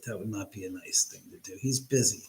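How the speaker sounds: soft whisper, half to himself; whispered aside. Real, not acted. Streamer telling the chat, in a whisper. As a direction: let it slip out as a secret. Aside about not calling Scott.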